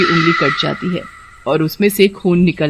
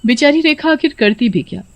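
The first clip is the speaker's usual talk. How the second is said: ordinary delivery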